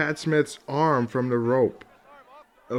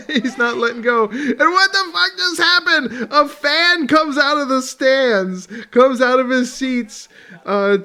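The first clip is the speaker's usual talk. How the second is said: higher and louder, laughing in shock; can't believe what he's seeing, cracking up; laughing disbelief; 8 semitones higher, 7 dB louder than usual. Real, not acted. Reacting to a fan jumping in, astonished laughing.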